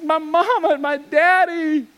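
high-pitched imitation of a bawling wife; hammed-up tearful whine; mock crying wail. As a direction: wail it high like a homesick child. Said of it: "she starts crying really loud".

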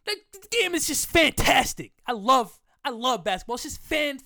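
loud high over-the-top gush; hammed-up gushing. Podcaster hams up his love of basketball, loud high gushing. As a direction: gush like a giddy superfan.

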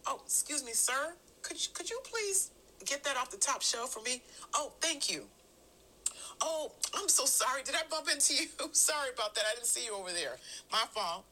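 sweetly apologetic, as if to a stranger; polite public voice. Acted. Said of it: "I may sound something like this".

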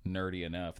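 ordinary delivery

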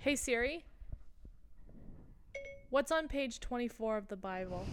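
clear, careful voice-assistant command; talking to Siri. Real, not acted. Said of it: "Let's ask Siri".

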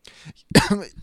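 sudden choking cough. Real, not acted. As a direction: cough hard as something goes down wrong. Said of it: "Ich hab mich gerade verschluckt".

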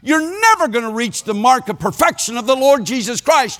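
high, chanting preacher cadence; half-sing the verses like a revival preacher; singsong preaching. Retold or imitated. Preacher chanting scripture at Willard in singsong cadence.

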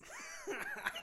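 high laughing burst; burst of laughter. Real, not acted. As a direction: break into laughter. Laughing after fake hiccup.

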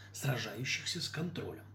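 plain reading voice

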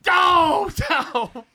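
high, loud pleading shout; panicked yell. Acted. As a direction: yell to make it stop. Will begging while hit.

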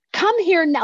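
high, loud demanding call; mock scream at God. Fed-up woman demanding God come now, high loud yell. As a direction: yell it up at the sky like a dog command. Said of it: "like, just scream".

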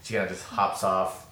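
ordinary delivery

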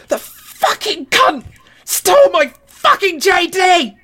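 high, slow, screeching mock fury; screeching drunk girlfriend. Drunk girlfriend screeching at the party about her stolen bottle. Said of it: "She was, like, screeching".

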